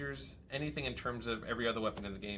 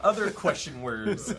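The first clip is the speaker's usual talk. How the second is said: laughing; 6 semitones higher, 9 dB louder than usual. Real, not acted. Host breaks into laughter.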